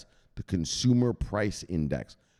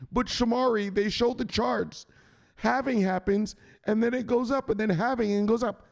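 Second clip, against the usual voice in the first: high mock whine of a disappointed fan; mocking whiny viewer; 14 semitones higher than usual. Mocking a viewer who believed the halving, high whine. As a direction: whine like a naive crypto kid. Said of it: "Dickhead, that's coincidence".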